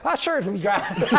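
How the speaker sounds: high, jokey Irish lilt; mock Irish accent. Guest imagines booking the Irish-accent man, in mock Irish. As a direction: toss it off in a laughing stage-Irish brogue. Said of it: "Get the guy with the Irish accent".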